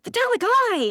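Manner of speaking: high, over-eager yelp of hello; hammed-up starstruck squeal; imitated yelling greeting. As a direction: greet your idol far too excitedly. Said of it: "just yelling at him".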